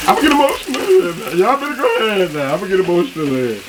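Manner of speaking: high, fast, hammed-up emotional voice; mock emotional. Reviewer hamming up being moved by the food, high and fast. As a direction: play at being overcome. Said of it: "I'm getting emotional".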